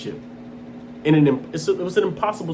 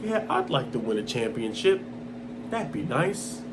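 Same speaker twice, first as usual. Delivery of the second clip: low, soft, half-interested mock quote; lukewarm; 5 semitones lower, 8 dB quieter than usual. Mocking what Kobe never sounded like, lukewarm. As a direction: say it like you hardly care. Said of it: "Does it sound like he's like".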